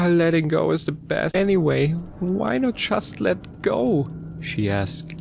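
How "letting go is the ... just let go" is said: high despairing voice. A narrator's reading. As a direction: let the words sink, tired of it all. Chen voiced again.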